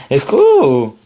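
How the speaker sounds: playful exclamation. Real, not acted. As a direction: stretch the last syllable of Escroco into a squeal. Jeu sur le nom d'une carte. (Escroco lancé pour rire, finale aiguë et traînante)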